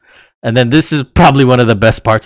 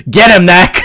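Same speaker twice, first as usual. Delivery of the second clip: imitating a wrestler's yell, slightly raised; mild retold shout, pitched higher; mock yell; 4 semitones higher, 4 dB louder than usual. Podcaster recapping Kenny egging on his goon, mild mock yell. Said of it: "and Kenny yells".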